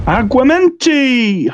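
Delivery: high, long-drawn incantation; spell casting. Acted. Bill casts a water charm against dragon fire, high spell casting. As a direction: sing out the spell high and stretched.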